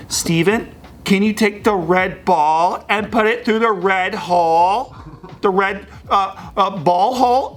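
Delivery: mock sing-song nurse talking to a child; talking down to a kid. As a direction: coax him like a toddler. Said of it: "She's talking to me like I'm a little kid".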